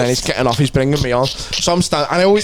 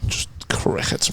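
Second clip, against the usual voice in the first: whispered deadpan; 9 dB quieter, slower than usual. Real, not acted. Deadpan after a joke flopped.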